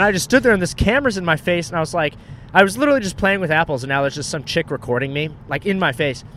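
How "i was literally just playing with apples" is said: usual speaking voice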